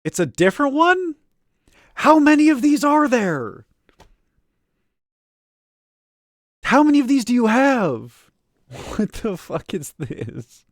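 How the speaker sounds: high, loud disbelief; incredulous. Real, not acted. Incredulous at another clip.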